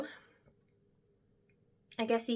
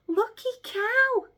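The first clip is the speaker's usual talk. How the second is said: much higher, slow, envious cry; envious squeal; 4 dB louder, slower than usual. Acted. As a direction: squeal it with mock envy. Envious exclamation.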